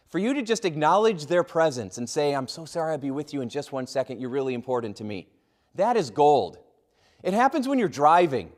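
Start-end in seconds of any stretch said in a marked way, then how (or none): 2.3-5.3 soft, low and sincere, eased down; a quiet heartfelt sorry to a waiting customer; soft caring apology. Shown as a demonstration. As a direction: reassure the customer gently. Demo of caring cashier.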